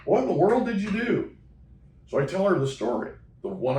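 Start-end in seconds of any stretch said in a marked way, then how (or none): 0.0-1.3 high, astonished question; amazed question. Retold or imitated. Clerk marvels at the weight loss, high and astonished. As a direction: marvel at how much weight is gone.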